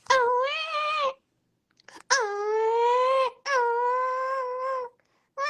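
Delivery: high loud wailing cry; mock baby cry. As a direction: wail like a baby. Fake baby crying.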